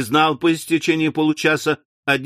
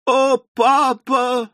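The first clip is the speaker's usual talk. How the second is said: reproachful cry; 9 semitones higher, much slower than usual. Acted. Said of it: "с упрёком воскликнула".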